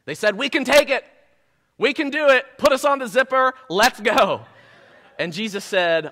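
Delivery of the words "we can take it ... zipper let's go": higher, gung-ho bravado; mock overconfident enthusiasm; cocky eagerness. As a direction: pump yourself up for a dare. Disciples voiced as overconfident thrill seekers.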